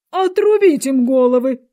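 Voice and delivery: loud, high, slow command; shouted sentence. A narrator's reading. Queen sentences the gardeners, shouting imperiously. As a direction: proclaim it loudly, dragging the words.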